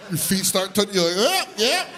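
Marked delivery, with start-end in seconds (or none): 1.2-1.9 high strained squeak as if hoisted up; high little yelp; dangling kid. Acted. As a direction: squeak it out on tiptoe. Acts kid lifted onto tiptoes.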